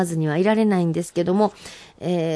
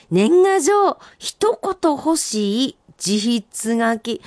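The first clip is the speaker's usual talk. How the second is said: senryu recital; 2 semitones higher, much slower than usual. Acted. Reading listener's senryu aloud.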